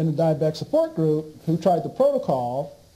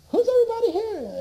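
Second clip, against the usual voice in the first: high, amazed retold question; faster than usual. He walks into a packed room, amazed. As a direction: pitch it up in disbelief.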